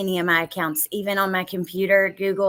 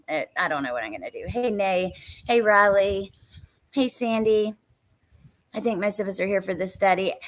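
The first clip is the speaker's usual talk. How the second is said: normal talking voice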